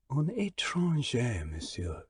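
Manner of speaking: put-on Un étranger said softly in a French accent; spoken in French. Stranger replying to the Englishman softly in French. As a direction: let Un étranger, monsieur fall quietly in French. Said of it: "he said in French".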